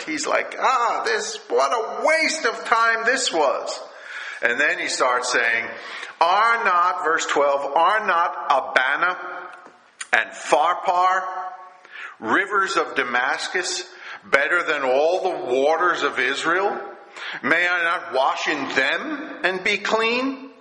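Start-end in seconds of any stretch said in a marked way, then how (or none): 0.6-3.8 petulant huff, pitched way up high; sulky scoff at the wasted trip. Acted. Naaman huffing at a wasted trip, petulant and high. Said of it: "Naaman is furious. He's angry. He's petulant". 6.2-20.5 indignant demand, pitched up high; in a rage. Acted. Naaman arguing that Damascus rivers are better, high and indignant. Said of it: "went away in a rage".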